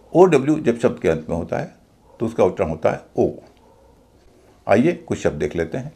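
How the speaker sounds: normal talking voice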